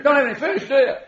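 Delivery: high laughing jab; mocking little dig with a laugh; teasing dig. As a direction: poke fun at them, laughing. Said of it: "he's digging them a little bit".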